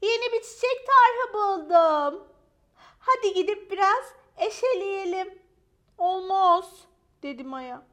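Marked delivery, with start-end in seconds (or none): 0.0-5.4 excited dog friend. Acted. Ponçik invites Maya to dig, high excited voice. 6.0-6.8 high, loud, drawn-out no; dramatic refusal. Acted. Maya turns down Ponçik's invitation, high dramatic refusal.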